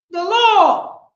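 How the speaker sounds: emphatic repeat; loud. Real, not acted. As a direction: declare it.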